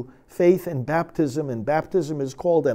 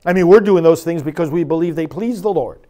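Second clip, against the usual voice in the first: usual speaking voice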